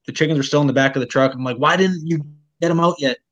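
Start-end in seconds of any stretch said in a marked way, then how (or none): 1.6-3.2 higher, annoyed demand, imitating himself; retold anger. To his partner about chickens still in truck, annoyed. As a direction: snap the question like he's fed up. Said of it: "So I got mad".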